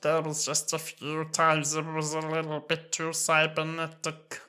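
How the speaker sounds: slow, garbled robot drone; overly cybernetic Cyberman. Acted. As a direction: blur the words into mechanical buzzing. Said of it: "But these ones, yeah".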